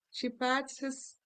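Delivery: plain reading voice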